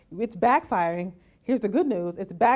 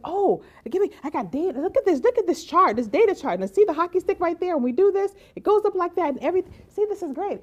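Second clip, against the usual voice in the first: much higher, louder, overeager data pitch; mock eager pitch; 9 semitones higher, 5 dB louder than usual. Person showing their chart to an opponent, overeager and much higher. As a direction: gush over the chart like a salesman. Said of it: "and then you said".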